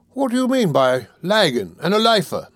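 high-pitched, baffled demand. A narrator's reading. Newcomer baffled by thieves' slang, demanding. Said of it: "demanded Mister Boulter".

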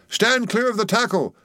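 high, sudden warning call; cried warning. A narrator's reading. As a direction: call out like a bursting rocket. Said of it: "cried a voice like the bursting of a rocket".

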